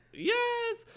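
very high delighted yes; mock cheer. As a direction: squeal it like a gleeful fan. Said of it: "And I was like".

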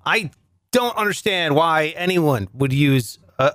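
ordinary talk, nothing marked

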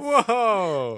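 loud, high, laughing whoa; big laughing cheer; excited whoop. Real, not acted. Cheering along with co-host.

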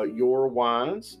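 ordinary delivery

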